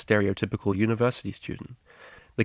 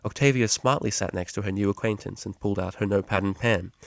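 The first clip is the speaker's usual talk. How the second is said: ordinary delivery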